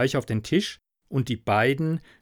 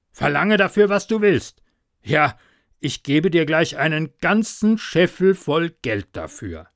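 loud, higher, urgent offer; eager pleading; 3 semitones higher, 5 dB louder than usual. Acted. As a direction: push the deal eagerly. Farmer begging to buy wizard.

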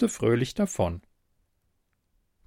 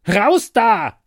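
loud, high yell of command; angry shout; 9 semitones higher, 9 dB louder than usual. Acted. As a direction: shout to chase someone out. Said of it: "schrie Ute". (wütend geschrien)

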